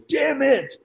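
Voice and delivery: high, strained yell; hammed-up action-hero shout; shouted catchphrase. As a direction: holler it like a fan driving by. Passer-by yells catchphrase at actor.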